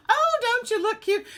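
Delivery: high, loud gushing coo; gushing greeting. Retold or imitated. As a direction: coo it like a delighted older lady. Voicing the director's greeting.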